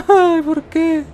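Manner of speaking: very high, squeaky mock lament; hammed-up wail. Player laments being caught, hammed-up wail. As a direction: wail it high, playing up the misery.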